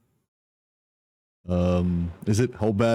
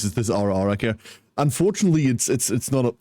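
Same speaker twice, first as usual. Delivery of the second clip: usual speaking voice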